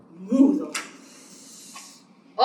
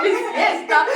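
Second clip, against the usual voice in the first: high wailing mock sobs through the words; sobbing bear; 5 semitones higher, 5 dB louder than usual. Reading to a child, the bear sobs about his stolen party. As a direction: blubber it like a heartbroken bear. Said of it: "El oso solloza, el oso berrea".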